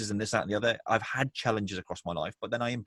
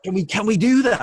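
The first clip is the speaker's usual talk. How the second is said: high, breathless astonished client; imitating a stunned, thrilled client; amazed disbelief; 12 semitones higher, 10 dB louder than usual. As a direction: play a client who can't believe it's allowed. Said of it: "And then it's like".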